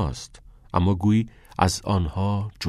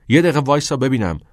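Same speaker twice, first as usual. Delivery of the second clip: urgent interruption; 6 semitones higher, 6 dB louder, faster than usual. Acted. Knox confronts angry Neil.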